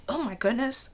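just recovering from coughing; strained after a coughing fit; choking on a drink. Real, not acted. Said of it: "Who starts off a podcast choking?".

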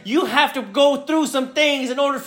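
high, driving sermon build; preaching at full force. Acted. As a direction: preach it up to the peak. Preaching climax, higher pitch.

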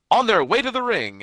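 announcer voice. Retold or imitated. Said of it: "he goes".

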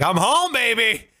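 mock relieved traveler.

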